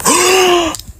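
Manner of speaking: high, sharp fake gasp of shock; hammed-up shocked gasp; mock gasp. Mock shock at own news.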